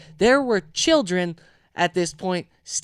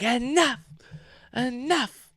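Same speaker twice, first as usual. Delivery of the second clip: high-pitched, stretched "enough"; imitated exasperated refusal; 6 semitones higher, slower than usual. Drunk Pat cutting off questions, exasperated. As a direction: mimic a fed-up friend, pitched up.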